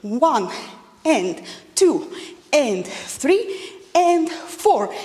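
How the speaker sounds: slow, drawn-out counts; slow, even beat counts; counting the right way. Shown as a demonstration. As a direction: count the correct version slowly. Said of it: "follow this rule".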